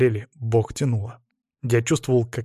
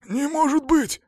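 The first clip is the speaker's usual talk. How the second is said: much higher, strained hoarse cry; hoarse disbelief. A narrator's reading. Cornered man struggles to break free, hoarse disbelief. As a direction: repeat it high and husky, disbelieving. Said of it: "сипло повторил он".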